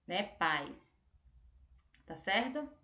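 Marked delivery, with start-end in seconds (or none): 0.2-1.0 Pais as one low falling syllable, PAIS; low, softer Pais with the weight on the a; stress on PA. Shown as a demonstration. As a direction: PAIS in a single beat, i only a glide. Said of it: "a sílaba tônica mais forte é o pá".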